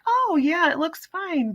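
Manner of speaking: telling a hurt brother it looks fine; fake reassurance. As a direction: hide the panic, sound cheerful. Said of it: "I was just like".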